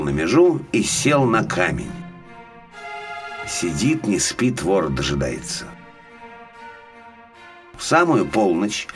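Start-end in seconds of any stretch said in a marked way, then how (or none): none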